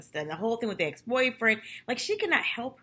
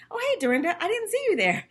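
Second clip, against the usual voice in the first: higher, lightly airy sing-song; mock breezy greeting; 11 semitones higher, 3 dB louder than usual. Recapper mocking Sonia's awkward icebreaker, airy and high. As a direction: play a fake-casual hello. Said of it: "Sonia looks over and says".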